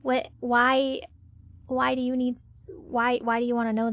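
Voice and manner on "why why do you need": ordinary talk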